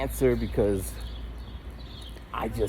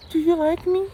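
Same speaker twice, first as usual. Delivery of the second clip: very high whiny imitation; mock needy girlfriend. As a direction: pitch up high as the clingy girlfriend. Mimicking girlfriend's needy question.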